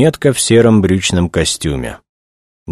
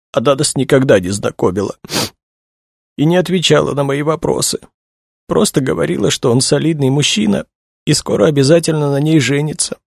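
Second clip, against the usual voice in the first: high, tearful female character voice; distressed young woman; 10 semitones higher than usual. A narrator's reading. Young woman tells investigators about her friend, tearful. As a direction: keep it pitched well up, upset and confiding.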